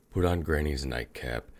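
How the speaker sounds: ordinary delivery, nothing marked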